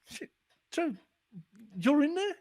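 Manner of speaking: high excited exclaim; telling his friend he's in the book, high and excited; mock excited call. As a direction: squeal it up with excitement. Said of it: "phone you and go".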